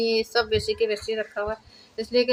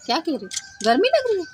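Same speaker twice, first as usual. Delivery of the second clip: quick, high, louder questions; fussing questioning; 8 semitones higher, 5 dB louder, faster than usual. Real, not acted. Asking the screamer if they feel hot, high quick fussing. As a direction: ask it like checking on a noisy little one. Asks the noisy one what's wrong.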